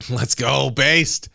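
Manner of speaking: loud, laughing hype shout; hyped cheer. Real, not acted. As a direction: cheer the joke on loudly. Cheering a joke about himself.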